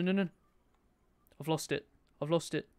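ordinary talk

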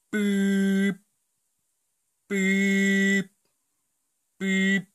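high beeps imitating a phone ring; mock phone beep. Caller waiting as the phone rings, beeping.

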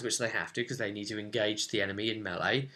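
normal talking voice

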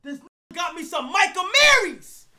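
high, loud disbelief; excited shout. Real, not acted. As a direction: shout the gift out to everyone. Hyped repeat of the reveal.

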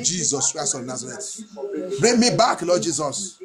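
ordinary talk

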